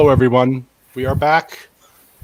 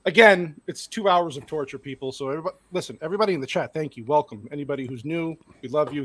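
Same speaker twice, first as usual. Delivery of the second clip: ordinary talk, nothing marked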